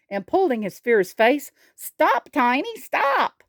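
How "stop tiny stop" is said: high, urgent cry to stop; high-pitched alarmed mock yell. A narrator's reading. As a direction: cry out to halt a charging friend. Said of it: "yelled Gip Villo".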